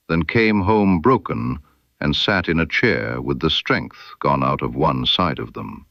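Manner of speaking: ordinary delivery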